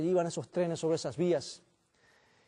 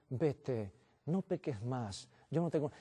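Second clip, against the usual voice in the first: softer, slower, tender words of release; gentle forgiveness; 4 semitones lower, 8 dB quieter, slower than usual. Retold or imitated. Jesus forgiving the adulterous woman, soft and slow. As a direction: speak softly as if forgiving her. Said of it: "la perdonó y dijo".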